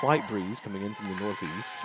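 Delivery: hushed golf commentary; golf announcer voice. Pretend. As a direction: quietly describe the weather like golf TV. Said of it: "my golf announcer's voice".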